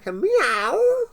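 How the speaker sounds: very high meow; cat meow. A narrator's reading. The cat in the farmyard uproar, high meow. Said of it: "goes the cat".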